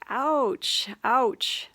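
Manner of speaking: "Ouch" yelped high and loud, hammed-up pain; mock hurt ouch. Stung by a difficult person's behaviour, mock pained yelps, high and loud.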